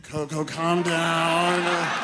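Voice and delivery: loud, very drawn-out plea; exasperated calming. Acted. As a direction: stretch it out loudly to restrain her. Keepers trying to calm upset gorilla.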